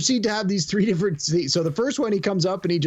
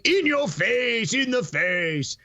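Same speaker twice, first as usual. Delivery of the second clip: higher, drawn-out mock shout; mock catchphrase shout; 6 semitones higher, much slower than usual. Naming the famous police station scene, mock catchphrase shout. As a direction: punch out the catchphrase, stretching it.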